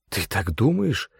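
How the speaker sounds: tentative, hopeful question; mildly hopeful, checking. A narrator's reading. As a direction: ask with a touch of hope. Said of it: "с надеждой спросил я".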